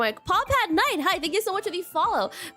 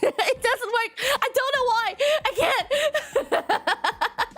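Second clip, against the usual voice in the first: higher, laughing through the words; 9 semitones higher, much slower than usual. Real, not acted. Streamer laughing at how fake her accent sounds. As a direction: giggle helplessly while admitting it.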